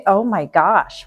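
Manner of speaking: normal talking voice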